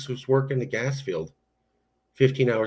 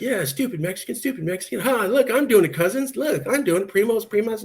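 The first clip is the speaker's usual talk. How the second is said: much higher, bratty mock taunt; hammed-up boyish taunting, pitched way up; dumb teen boy showing off; 12 semitones higher than usual. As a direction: play his thirteen-year-old self showing off to cousins. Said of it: "I was like".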